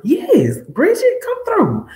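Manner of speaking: very high, loud cheering; excited cheer. Real, not acted. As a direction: hype Bridget up as she comes through. Cheering a viewer on stream.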